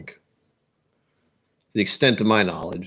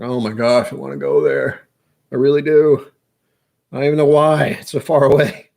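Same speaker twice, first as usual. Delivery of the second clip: higher, louder gushing wish, retold; retold longing; 4 semitones higher, 4 dB louder than usual. Recalling watching Roy in Islay, retold excited longing. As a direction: gush it, building into an exaggerated yearning. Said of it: "I was like".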